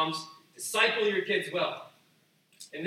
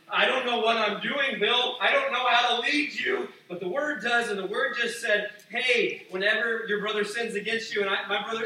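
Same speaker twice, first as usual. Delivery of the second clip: higher, louder earnest confession; earnest pretend plea; 6 semitones higher, 9 dB louder than usual. Disciple admitting to Bill he can't lead, high and loud. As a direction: pour it out to Bill, raised and eager.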